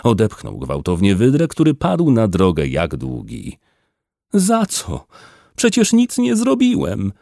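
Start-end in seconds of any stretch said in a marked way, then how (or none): 4.3-7.1 high, whining protest; whimpering. A narrator's reading. Wydra, shoved onto the road, protests to Bernard, whimpering. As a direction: whimper it like a wronged poor wretch. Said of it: "zaskomlał biedak".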